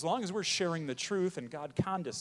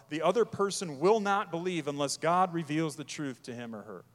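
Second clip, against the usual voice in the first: usual speaking voice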